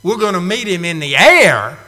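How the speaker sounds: preacher's ringing emphasis; emphatic preaching. Real, not acted. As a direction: drive home every word of it. Preacher repeats his point louder.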